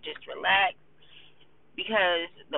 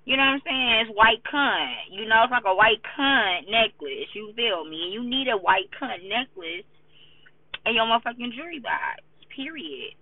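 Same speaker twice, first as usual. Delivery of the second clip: hyped emphatic; 5 semitones higher, 4 dB louder than usual. Real, not acted. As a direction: drag each word out with attitude. Hyped about necklace.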